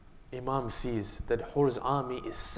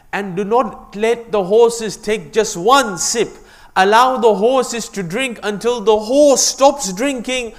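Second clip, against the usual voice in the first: preaching at full force; 9 semitones higher, 13 dB louder than usual. Acted. Imam commands his men.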